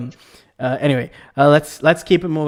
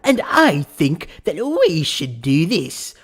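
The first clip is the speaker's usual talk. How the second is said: slow, drawn-out upper-class British impression; mock a snooty British aristocrat, dragging the words; aristocratic British; slower than usual. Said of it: "aristocratic British person ever".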